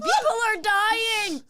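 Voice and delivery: high, drawn-out mock cry; mock horrified shout. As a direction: cry it out in comic alarm, stretched. Mocking curtain room, retold cry.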